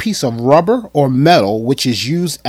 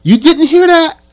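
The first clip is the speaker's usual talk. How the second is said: mock disbelief; 13 semitones higher, 7 dB louder than usual. Mock surprise opening to viewers.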